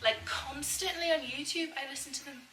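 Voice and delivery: overdone, singsong Scottish accent. Shown as a demonstration. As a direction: exaggerate the Scottish melody, ending phrases on an up. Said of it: "I'm probably overdoing the Scottish one with the melody".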